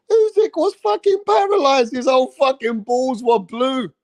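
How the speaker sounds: high mocking voice, easing back down; a touch of mock whine. Ranter keeps mimicking fans, high mock whine fading. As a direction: keep mocking the fans, pitch sliding down. Mocking fans' low blow claim.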